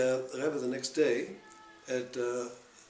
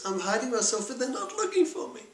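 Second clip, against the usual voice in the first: put-on higher, louder child's voice close to tears; upset little boy; 8 semitones higher, 3 dB louder than usual. Little boy tells the Baal Shem Tov why he is crying, high and pleading. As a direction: play the hurt child nobody looks for.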